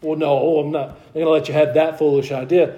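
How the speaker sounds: ordinary delivery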